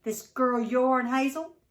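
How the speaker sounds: loud, slow, angry demand; pouncing. A narrator's reading. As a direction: drag the words out at her. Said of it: "immediately pounced on her".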